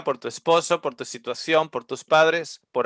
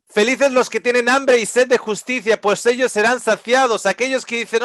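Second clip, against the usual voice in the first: raised, pleading proclamation; high pleading preaching; 7 semitones higher than usual. Acted. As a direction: plead like a sinner to the Lord. Preaching, voicing a pleading believer. (predicación alta y suplicante)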